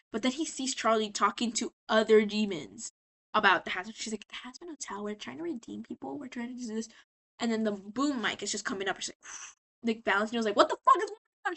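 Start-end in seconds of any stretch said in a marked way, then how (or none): 4.3-6.9 much softer, confiding aside; hushed pitch. Retold or imitated. Charlie to other demons during filming, hushed. 10.6-11.6 high, louder retold yell; mock angry outburst. Valentino when the boom mic drops, mock outraged. Director yells at interruption.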